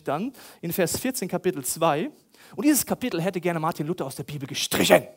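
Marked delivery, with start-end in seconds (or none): none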